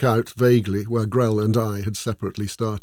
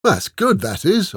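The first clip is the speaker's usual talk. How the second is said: a higher, eager burst of approval; approving; 9 semitones higher, 5 dB louder, slower than usual. A narrator's reading. Ola praises the poem to Esmeralda, higher and eager. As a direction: praise it with wide-eyed delight. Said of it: "Ola said approvingly".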